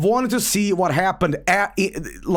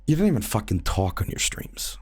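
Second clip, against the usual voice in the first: low unvoiced sneer; hushed contemptuous jab; scornful whisper; 5 semitones lower, 6 dB quieter than usual. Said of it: "and he goes".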